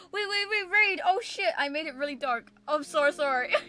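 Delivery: high, loud and flustered; hurried excited apology. Real, not acted. Raid arrives, flustered.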